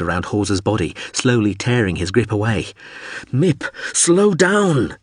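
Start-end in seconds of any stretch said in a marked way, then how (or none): 3.3-5.0 high, drawn-out yell; desperate shout. A narrator's reading. Man slipping off ramp begs pilot, long high shout. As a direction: stretch the plea out, pitched high. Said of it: "he shouted".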